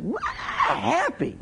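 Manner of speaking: high mock squeal of a happy toddler; toddler's joyful squeal. Preacher imitating his daughter's joy, a high mock squeal. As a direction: squeal like a delighted three-year-old. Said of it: "And she's just".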